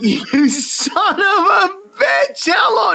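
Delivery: high, slow, laughing yell; mock furious shout. Woman catching her boyfriend asleep on the phone, laughing mock fury. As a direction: blow up at him half laughing. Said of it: "I went".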